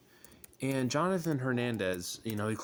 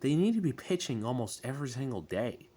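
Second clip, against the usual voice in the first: ordinary talk, nothing marked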